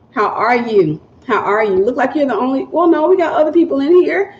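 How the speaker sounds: ordinary delivery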